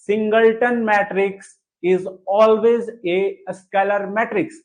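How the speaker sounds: very slow, word by word, for writing down; slow dictation. Shown as a demonstration. Teacher dictates the rule for students to write, slowly. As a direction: dictate it so they can copy. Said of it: "लिखिए इसको".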